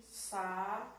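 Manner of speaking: "Saúde" in three separated syllables; slow dictated "Saúde". Shown as a demonstration. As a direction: break "Saúde" into sa, ú, de with long pauses. Teacher splits the word into syllables.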